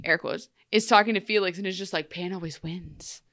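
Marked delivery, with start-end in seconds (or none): 2.1-3.2 hushed, slowed, lightly eerie mock Pan; stilted Pan imitation. Henry, possessed by Pan, quietly telling Felix, in a soft, slow mock-eerie voice. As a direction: breathe it out slow and quiet like a villain in disguise. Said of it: "emulate the way Pan spoke".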